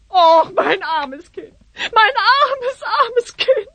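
high, sobbing wail; wailing lament. Acted. Mother wails over her missing daughter, high and tearful. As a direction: cry out over her lost child. Mother sees the spell book.

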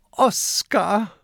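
high, relieved call; high character voice calling. A narrator's reading. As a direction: call out the friend's name high. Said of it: "ruft Kokosnuss".

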